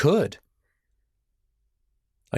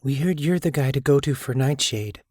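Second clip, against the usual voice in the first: ordinary delivery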